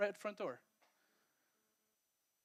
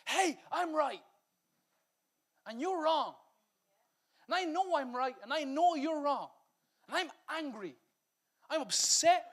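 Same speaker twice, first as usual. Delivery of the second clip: higher, mock-indignant ranting; lightly voiced angry spouse, higher and insistent; angry self-righteous voice; 5 semitones higher than usual. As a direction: play the wounded one who insists he's right. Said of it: "our anger screams".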